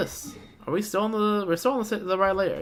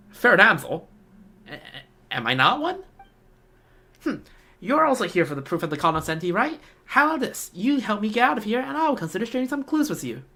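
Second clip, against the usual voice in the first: put-on high girl voice; 6 semitones higher than usual. Thief girl explaining herself to the travellers in a high voice. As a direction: play the young woman pleading her case.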